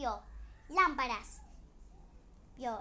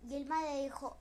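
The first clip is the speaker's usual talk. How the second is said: ordinary delivery, nothing marked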